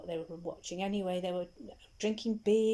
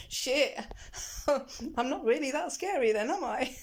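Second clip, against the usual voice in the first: higher, louder, half laughing; mock shock at herself; laughing dismay; 6 semitones higher, 5 dB louder than usual. The guard thinks she is scary, laughing higher.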